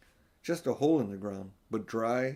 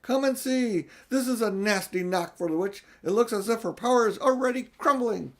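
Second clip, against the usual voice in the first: high, loud, gleeful excitement; delighted; 10 semitones higher, 4 dB louder than usual. Acted. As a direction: gloat with joy at the witch's bad luck. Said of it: "almost dancing with delight".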